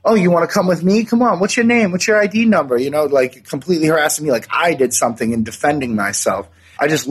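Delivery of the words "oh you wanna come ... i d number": high, sneering imitation of the cop; mocking cop voice. Retelling how the cop taunted him after the fight, mock high sneer. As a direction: mimic the cop with a lot more pitch. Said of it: "And then he's all".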